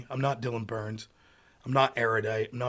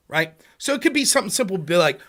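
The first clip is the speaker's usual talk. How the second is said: usual speaking voice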